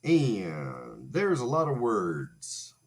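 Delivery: mock presenter read. Presenter voice reading title.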